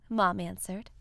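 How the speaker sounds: plain reading voice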